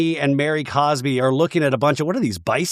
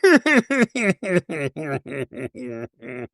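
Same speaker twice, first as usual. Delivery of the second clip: a hammed-up copy of her laugh; Meredith laugh impression. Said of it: "the Meredith laugh".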